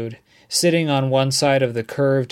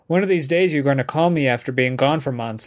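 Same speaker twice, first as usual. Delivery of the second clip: plain reading voice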